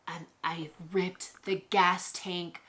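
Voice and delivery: breathless stunned whisper; hushed horrified whisper; shocked whisper. Retold or imitated. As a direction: whisper it in disbelief at what you just did. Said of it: "I'm like".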